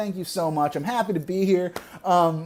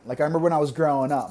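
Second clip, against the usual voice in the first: normal talking voice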